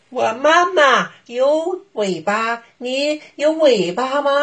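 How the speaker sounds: put-on high, slow questioning child voice; a clever bunny testing; little bunny voice. Bunny tests the wolf.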